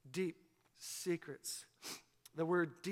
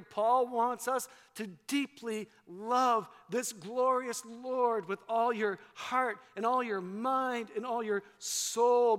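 usual speaking voice

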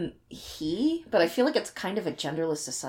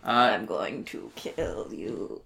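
creepy alien voice; 9 semitones lower, 4 dB quieter, much slower than usual. Pretend. Joking menace during E T talk.

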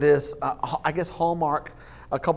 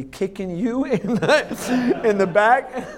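laughing through punchline; 6 semitones higher, slower than usual. Retold or imitated.

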